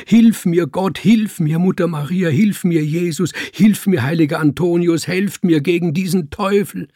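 high, loud desperate plea; crying out. A narrator's reading. Man begs saints for help against devil, crying out. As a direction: shout for help, high and insistent. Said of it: "schrie er auf".

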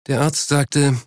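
ordinary delivery

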